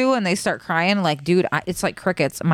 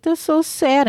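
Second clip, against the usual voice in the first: milk the sadness, half-joking; imitating himself teary over a dog on TV; mock sad whine; 9 semitones higher than usual. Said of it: "and I was like".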